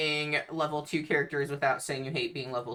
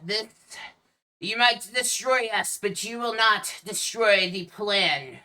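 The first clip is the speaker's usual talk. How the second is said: louder, higher defiant enemy voice; defiant villain; 5 semitones higher, 6 dB louder than usual. Acted. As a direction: declare it forcefully at your attackers. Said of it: "looks at you all and he says".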